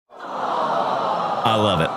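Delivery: slow whispered i love it; breathy whisper. Real, not acted. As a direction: whisper it slowly, moved. Touched by viewer's first auto.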